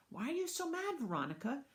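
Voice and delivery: put-on high, protesting child's question; plaintive question. Boy asking babysitter why she's mad, plaintive.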